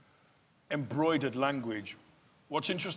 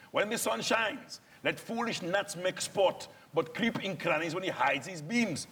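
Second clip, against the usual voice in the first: put-on Shakespeare verse in a Nigerian accent, pitched up; 3 semitones higher than usual. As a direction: declaim it like a Nigerian Dromio. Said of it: "we're foreigners speaking this".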